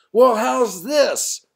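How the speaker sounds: high, loud, slow challenging shout. Acted. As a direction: fling it at them as a dare. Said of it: "I'm shouting".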